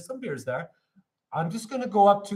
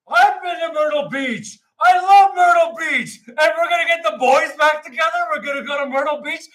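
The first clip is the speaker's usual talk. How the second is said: high, loud, gushing excitement; overjoyed; 7 semitones higher, 7 dB louder than usual. Retold or imitated. Moviegoer who loves Myrtle Beach, wildly excited. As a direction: shout along like a thrilled fan. Acting his delighted reaction to film.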